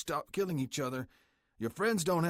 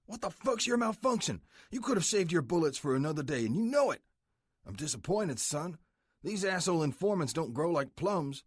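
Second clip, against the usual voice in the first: higher, louder angry scolding; harsh angry boss; 8 semitones higher, 3 dB louder than usual. Acted. President scolds his son after slamming him into the wall, angry. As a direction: tear into him for wasting the chance. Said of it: "His voice hit me in a harsh whisper".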